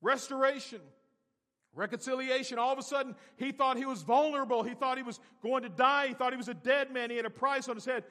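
high, loud sermon delivery; full-force preaching. Real, not acted. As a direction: preach it out with fervor.

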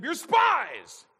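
explosive high shout; booming, high-pitched accusing shout; exclaimed accusation. Acted. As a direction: yell it out with a big exclamation point. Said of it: "there should be an exclamation point".

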